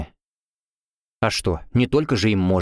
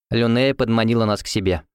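plain reading voice

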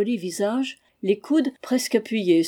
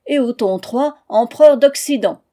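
higher and louder, racing to answer first; shouted answer; 4 semitones higher, 7 dB louder than usual. Acted. Prince racing his sister to answer, shouting. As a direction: blurt it out loud before his sister. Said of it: "cria Henri".

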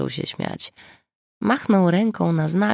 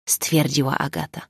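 plain reading voice

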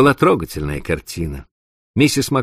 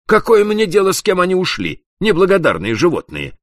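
much higher, louder outburst of scorn; a boss scorning the runaway workers; indignant exclamation; 13 semitones higher, 4 dB louder than usual. A narrator's reading. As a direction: fling out the insult in outrage. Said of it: "воскликнул тот".

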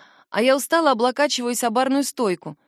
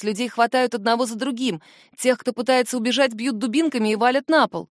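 ordinary delivery, nothing marked

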